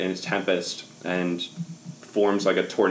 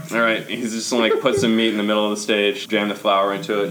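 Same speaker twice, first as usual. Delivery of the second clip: ordinary talk, nothing marked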